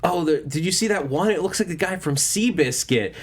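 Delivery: a hint of a gossiping Spider-Man; random Peter Parker.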